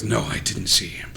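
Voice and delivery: soft, unvoiced secret answer; whispered reply. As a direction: breathe it as a whisper. Voiced character whispers reply.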